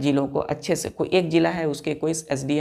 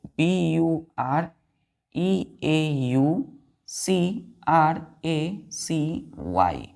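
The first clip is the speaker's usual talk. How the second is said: each letter called out slowly for the class; slow spelling out; much slower than usual. Shown as a demonstration. Teacher spelling bureaucracy slowly for her pupils. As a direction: spell the word letter by letter, unhurried. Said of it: "bureaucracy".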